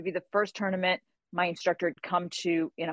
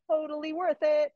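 high, singled-out punchline; joking lilt, pitched way up; playful teasing; 9 semitones higher than usual. Retold or imitated. Student joking with instructor about gray hairs, playful.

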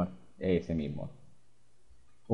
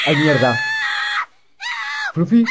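loud startled curse, real fright; startled outburst; 8 dB louder than usual. Real, not acted. Gamer startled by the scare, a loud curse. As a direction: jump at the scare and blurt it out. Jump scare while playing.

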